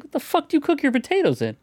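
high incredulous question; mock disbelief. Imitating a TikTok woman, high incredulous question. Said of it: "It's like".

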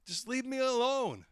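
loud, high, exasperated cry; angry outburst. Retold or imitated. Peter lashing out at his accusers, angry outburst. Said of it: "you're just so angry".

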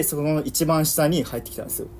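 ordinary talk

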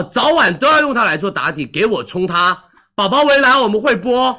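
loud, high hard-sell rally; halftime pep speech. Real, not acted. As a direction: pump up the buyers. Livestream hard-sell push. (高亢激昂的带货喊话)

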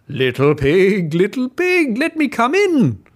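wolf calling to the pig's door, rising and coaxing; sly wolf calling. Acted. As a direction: wheedle at the door.

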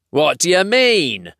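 indignant troll questioning; demanding troll. Acted. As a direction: press your captive for an answer. Troll demands answer from captive.

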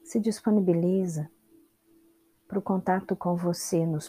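unhurried meditation guide with long pauses; calm instructions in short, spaced-out phrases; guided meditation. Real, not acted. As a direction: guide them through the body, leaving space after each instruction.

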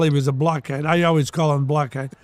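ordinary talk, nothing marked

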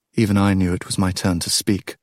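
ordinary delivery

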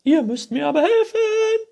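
very high, loud shriek; annoyed princess calling. A narrator's reading. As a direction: demand help, screeching crossly. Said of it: "was diese sehr verärgerte". (verärgertes, hohes Kreischen)

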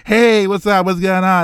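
high, loud, overly friendly hello; chummy dude greeting. Retold or imitated. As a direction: play the pushy friendly dude. Denim dude greeting narrator.

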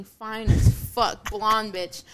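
ordinary delivery

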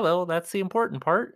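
ordinary delivery

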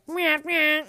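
loud, high meow-like alert sound; imitated notification noise. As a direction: mimic an annoying broken phone alert. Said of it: "I get a notification and it's like".

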